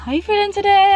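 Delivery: squeaky bright pretend okay; mock chirpy. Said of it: "He was just like".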